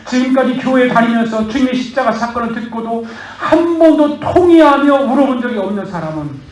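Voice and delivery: loud, high, impassioned sermon; fervent preaching. Acted. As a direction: preach it hard to the congregation. Pastor preaches at full force. (큰 소리로 열정적으로 설교)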